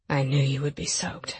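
lightly voiced man, low and teasing; low man's voice. A narrator's reading. Male character, seductive.

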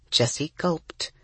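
ordinary delivery, nothing marked

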